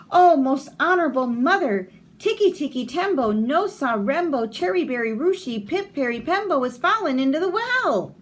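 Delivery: put-on high, eager child voice calling his mother; little Chang calling. Small boy calls to his mother at the well, high and eager.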